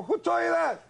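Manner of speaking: very high mock defensive cry; high-pitched evasive retort; startled comeback. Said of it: "I'm looking back and I go".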